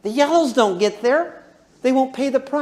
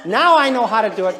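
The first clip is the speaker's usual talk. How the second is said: normal talking voice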